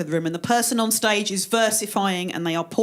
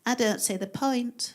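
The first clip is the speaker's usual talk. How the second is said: soft, slow, listless voice; quiet, dragging mock despair; depression; 5 dB quieter than usual. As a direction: give up as depression itself. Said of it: "And the depression goes".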